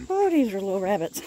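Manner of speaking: ordinary talk, nothing marked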